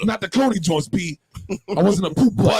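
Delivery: put-on New York accent. New York tough talk continues.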